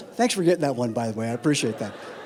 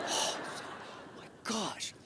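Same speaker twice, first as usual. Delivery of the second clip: ordinary delivery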